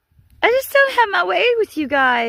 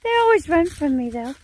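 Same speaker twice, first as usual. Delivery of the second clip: normal talking voice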